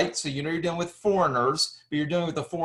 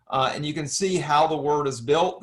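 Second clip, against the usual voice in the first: ordinary talk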